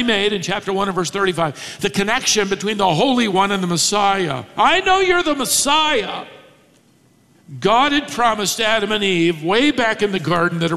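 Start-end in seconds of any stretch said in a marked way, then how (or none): 4.6-6.2 defiant recognition. Retold or imitated. Demon paraphrase.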